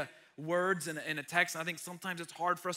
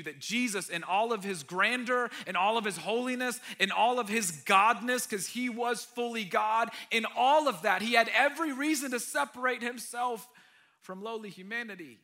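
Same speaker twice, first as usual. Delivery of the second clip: louder, rising preaching build; 6 semitones higher, 9 dB louder than usual. Real, not acted. Pastor builds to a climax about Jesus' holiness, loud preaching. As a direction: build it up like an altar call.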